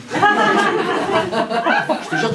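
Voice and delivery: high, slow strained squeak; a mock squeal of effort. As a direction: squeeze it out high and long like you're dying. Mid-story exclamation.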